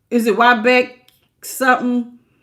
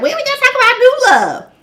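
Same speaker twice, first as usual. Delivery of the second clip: high, fast mock voice of the viewers; high quick teasing imitation; 13 semitones higher, 4 dB louder, faster than usual. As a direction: pitch way up and tease them. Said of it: "y'all be like".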